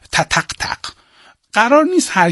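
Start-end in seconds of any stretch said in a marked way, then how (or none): 0.1-0.8 تتق، تق said as quick voiceless taps; tapped rhythm syllables. Shown as a demonstration. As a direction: tap out تتق، تق without voice. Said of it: "بگید مثلاً تتن".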